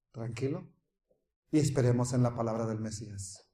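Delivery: low, soft, hushed preaching; quiet trust. Real, not acted. Preacher urging listeners to wait on the Messiah, hushed.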